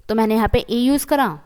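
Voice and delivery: ordinary talk, nothing marked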